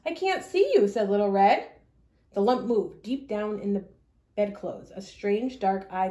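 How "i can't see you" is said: put-on girl voice. Girl voice to wolf.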